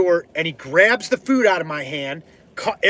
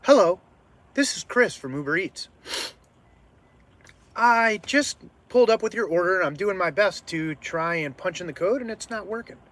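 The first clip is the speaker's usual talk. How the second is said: gently re-enacted courier on the phone; polite phone voice; 3 semitones higher, 3 dB quieter than usual. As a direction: imitate a careful, polite delivery call, trailing softer. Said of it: "This is exactly how I sounded".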